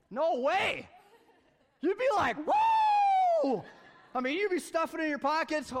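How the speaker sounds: giddy shouting joy over the money tree; excited shout. Pretend. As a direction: whoop like you just struck it rich.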